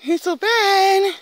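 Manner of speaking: high, drawn-out call to the dog. Real, not acted. Calling her dog Ben across the field, high and drawn out. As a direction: sing his name out high and slow.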